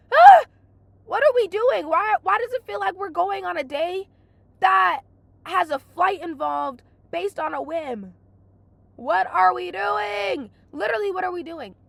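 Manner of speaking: high, loud exasperated rant; exasperated outburst. Retold or imitated. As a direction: throw your hands up, fed up. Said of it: "I cannot stand that".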